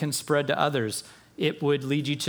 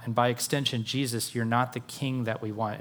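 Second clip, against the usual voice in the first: ordinary talk, nothing marked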